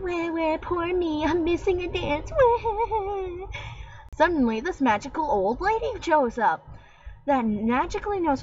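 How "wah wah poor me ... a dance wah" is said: high, hammed-up fake wailing; over-the-top pretend sobbing; mock crying. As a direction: whine like a spoiled kid faking tears. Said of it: "she starts crying".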